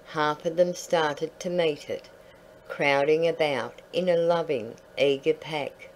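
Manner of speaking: plain reading voice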